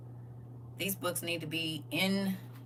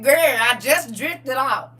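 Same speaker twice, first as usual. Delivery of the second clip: slow, loud, much higher cry of protest; startled protest; 7 semitones higher, 12 dB louder, much slower than usual. Retold or imitated. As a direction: drag it out in disbelief. Retelling her own startled reply.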